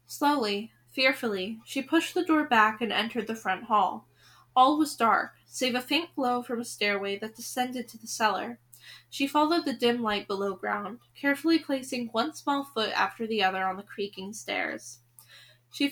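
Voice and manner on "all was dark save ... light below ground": ordinary delivery, nothing marked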